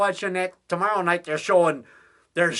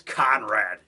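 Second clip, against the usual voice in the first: ordinary talk, nothing marked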